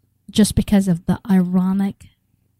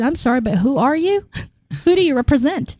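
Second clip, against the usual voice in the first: higher-pitched, puzzled retold question; baffled questioning; 6 semitones higher than usual. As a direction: ask it baffled, pitch lifting high. Retells her baffled question to caller.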